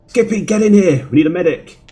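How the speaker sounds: man down shout. Acted. Calling the medic urgently.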